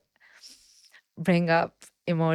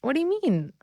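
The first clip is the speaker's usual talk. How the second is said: puzzled retort; 8 semitones higher than usual. Retold or imitated. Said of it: "And I'm like".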